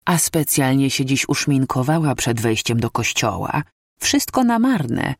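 usual speaking voice

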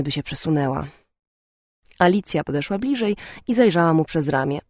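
ordinary delivery

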